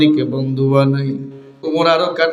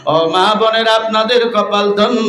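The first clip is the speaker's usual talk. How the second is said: higher, chanted address; fervent preaching; 6 semitones higher, 4 dB louder than usual. Real, not acted. Preacher praising mothers of Hafez students, fervent chanted address. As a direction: lift the voice and draw out line endings.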